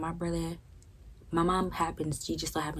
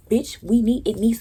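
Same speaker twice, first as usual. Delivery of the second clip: frustrated outburst; 6 semitones higher, 10 dB louder than usual. Real, not acted. Frustrated rant about mom.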